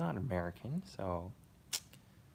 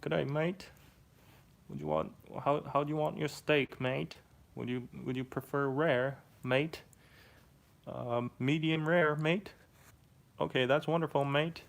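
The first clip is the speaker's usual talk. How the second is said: high-pitched put-on Australian accent; loud, high caricature of Australian speech; Aussie accent; 7 semitones higher, 4 dB louder than usual. Shown as a demonstration. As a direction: play an Aussie, each phrase rising at the end. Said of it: "all you have to do for Aussie".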